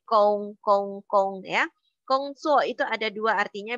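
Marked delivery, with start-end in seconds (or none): 0.0-1.7 "kong" with a hard k, repeated slowly; correct kong. Shown as a demonstration. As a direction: drill "kong" slowly, not "gong". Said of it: "Bacanya K O U N G". ("kong" diucapkan pelan dengan k keras)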